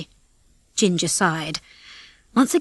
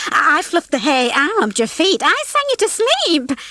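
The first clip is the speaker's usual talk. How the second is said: high, excited claims climbing higher; eager clamour; 8 semitones higher, 3 dB louder than usual. A narrator's reading. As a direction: pipe up eagerly, rising to the end. Hens crowding to share credit.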